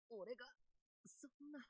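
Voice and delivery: breathy, crushed disbelief; stunned whisper. Acted. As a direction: barely get the words out. Defeated disbelief.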